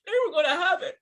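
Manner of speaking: upset, voice pushed up high; distraught protest. Real, not acted. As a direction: protest through rising grief. Upset outburst over losing his wife.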